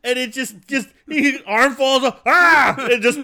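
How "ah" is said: high, loud imitated scream; sudden comic dying yell; mock death cry. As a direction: scream as if dropping dead, hammed up.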